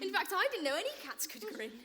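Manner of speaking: ordinary talk, nothing marked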